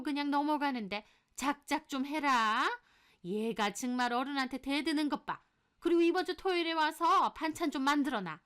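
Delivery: high, scolding voice giving orders; indignant mother-in-law nagging; bossy mother-in-law. Acted. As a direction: order the daughter-in-law about crossly.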